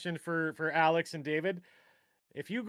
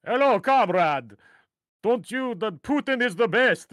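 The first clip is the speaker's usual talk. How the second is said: slow, hammed-up Russian accent greeting a comrade; mock Russian accent; 6 semitones higher, 8 dB louder than usual. Host imitates airsoft players greeting in a mock Russian accent. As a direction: lightly play a cartoon Russian airsoft player. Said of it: "they speak in an accent".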